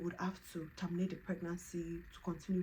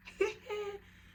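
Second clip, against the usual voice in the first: high laugh. Real, not acted. Laughing in joy.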